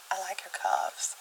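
teasing mock seductive whisper. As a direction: hush it like a secret come-on. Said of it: "I'm just imagining you there".

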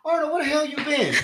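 high, loud, exclaimed question; imitated loud greeting. Scott greeting Arnold as a friend, imitated shout. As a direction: holler it like a long-lost buddy.

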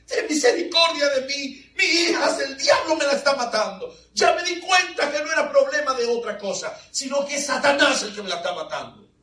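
high, loud desperate plea; a mother begging Jesus; high anguished pleading; pleading cry. Retold or imitated. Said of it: "esta madre llega y dice".

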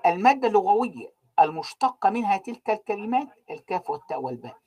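ordinary talk, nothing marked

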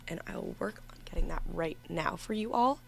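hushed, breathy aside; whispered aside. Real, not acted. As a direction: whisper it while busy. Whispers while cueing the song.

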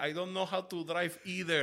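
very soft, small admission; sheepish confession. Retold or imitated. Driver admitting to passenger he can't drive, very soft. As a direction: confess it almost under your breath.

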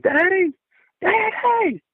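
very high desperate scream; high-pitched cry for help, child in terror; screaming for dad. Retold or imitated. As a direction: shriek for your father. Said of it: "I screamed".

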